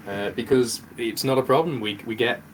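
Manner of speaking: ordinary talk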